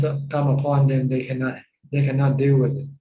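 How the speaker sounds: ordinary talk, nothing marked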